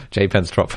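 "J Pence Trop" as "jay pence trop"; "J Pence Trop" anglicised, final P sounded; deliberately anglicised French. Shown as a demonstration. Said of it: "That's more like it".

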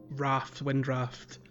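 ordinary delivery

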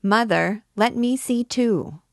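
put-on high, loud childish call; loud, high voice of an excited child; child shouting. A narrator's reading. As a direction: call out eagerly like a small boy.

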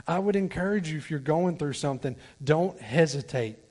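normal talking voice

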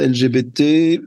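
ordinary talk

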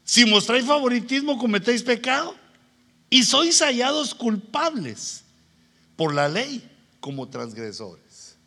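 loud, high preacher proclaiming a verse; emphatic scripture quoting. Real, not acted. Quotes scripture emphatically to congregation.

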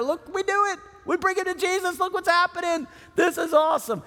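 rising into a shout of victory; excited friends cheering. Retold or imitated. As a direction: celebrate like you just pulled it off. Friends high-fiving on the roof.

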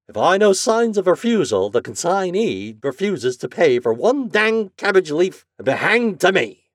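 high, loud, emphatic grumble; aggrieved express agent. A narrator's reading. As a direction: complain stubbornly at a slammed door. Flannery grumbles at the refusal.

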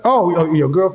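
normal talking voice

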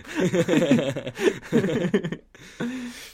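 high loud burst of laughter; real laughter. Real, not acted. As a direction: laugh hard at the joke. Laughing at own joke.

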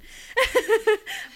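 high loud burst of laughter; sheepish laugh. Real, not acted. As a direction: laugh it off after the apology. Apologizes, then laughs.

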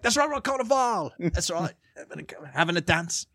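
high, exaggerated British accent; hammed-up British party bloke. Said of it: "he sounds like classic British".

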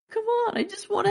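put-on very high kid voice; squeaky, pleading mock child; pleading kid sidekick. Acting out the kid begging the hero, high pleading voice. Quoting the kid's plea.

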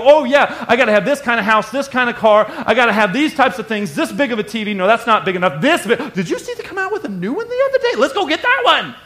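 greedy consumer. Retold or imitated. Preacher acting a shopper craving a new TV, high and giddy. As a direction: gush like a kid wanting the biggest TV.

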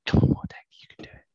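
urgent whisper of encouragement; whispered cheer. Real, not acted. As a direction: whisper it like a prayer for the dice. Cheering a dice roll quietly.

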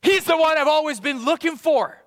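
high, loud joyful cry of recognition; finally finding the one they sought; excited realization. Retold or imitated. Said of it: "we're like".

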